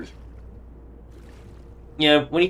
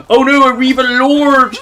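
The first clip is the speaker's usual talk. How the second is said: high, loud, slow hammed-up alarm; mock panic; 12 semitones higher, 9 dB louder than usual. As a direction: gasp out "Reaver Lord" in pretend terror.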